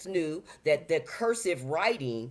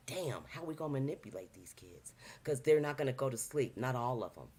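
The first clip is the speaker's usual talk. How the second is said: much softer, frustrated scheming aside; scheming mutter; 4 semitones lower, 7 dB quieter than usual. Retold or imitated. The elites plotting against the kids, in a soft frustrated mutter. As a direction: mutter like plotters stuck on a problem. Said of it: "would realize, like".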